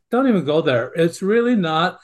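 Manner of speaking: ordinary talk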